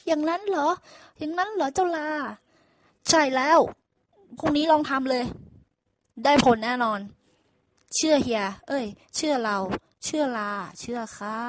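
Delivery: lively animal chatter, excited; excited exchange. Acted. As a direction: ox marvels, donkey insists loudly. Ox and donkey dialogue.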